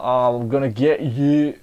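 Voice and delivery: slow, drawn-out bandit threat; mock bandit. Reviewer imitating how bandits would talk, mock bandit. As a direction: put on a hammy bandit voice. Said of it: "Bandits would be like".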